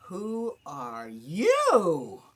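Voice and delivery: a dramatic reading for the voice lesson, slow and loud. Shown as a demonstration. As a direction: demand it slowly and forcefully. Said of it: "say it a different way with a different meaning".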